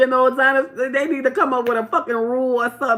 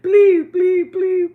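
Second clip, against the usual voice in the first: high, loud pleading imitation; mock begging; 11 semitones higher, 9 dB louder, much slower than usual. Mocking a man begging the ladies, high loud pleading. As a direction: whine please like a begging man.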